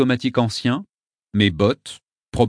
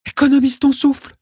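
put-on high, loud firm girl; young girl voice; 6 dB louder than usual. A narrator's reading. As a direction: urge him to save breath. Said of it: "me dit Aîné".

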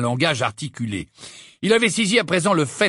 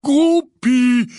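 loud, high call upward at the giant; loud wary shout up at a giant; 10 semitones higher, 7 dB louder than usual. A narrator's reading. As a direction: yell his brother's name up into the tree. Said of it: "cria Hagrid".

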